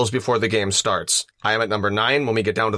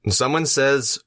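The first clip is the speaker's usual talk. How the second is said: normal talking voice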